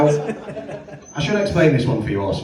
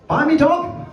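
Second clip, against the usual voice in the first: high, squeaky outrage on the punchline; 11 semitones higher, slower than usual. Acted. Comic telling the crowd about the dog, squeaky and high. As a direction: squeak it out in mock shock.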